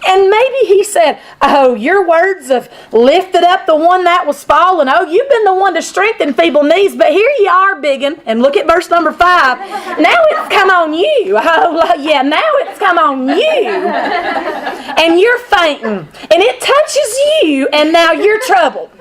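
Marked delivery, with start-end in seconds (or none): 1.4-8.2 mocking, sing-song sneer; snide taunt to a suffering friend; snarky. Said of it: "if he said it like that". 10.0-18.9 snarky laughing taunt. Retold or imitated. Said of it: "if he said it like that".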